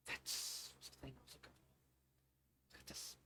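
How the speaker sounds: breathy secret whisper, slow. Acted. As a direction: whisper as if hiding in the library. Secret discovery in the library.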